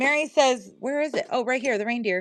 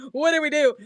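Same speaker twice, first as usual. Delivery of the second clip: laughing; 7 semitones higher than usual. Retold or imitated.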